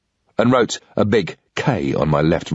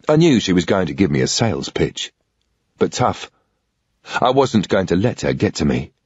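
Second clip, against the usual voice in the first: ordinary delivery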